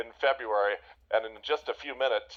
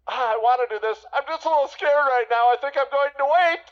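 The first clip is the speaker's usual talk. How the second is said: hesitant scared buyer; 11 semitones higher, 7 dB louder than usual. Retold or imitated. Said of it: "No, they say".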